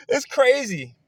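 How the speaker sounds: loud, high, amazed exclamation; real giddy excitement. Real, not acted. Exclaims right after laughing hard.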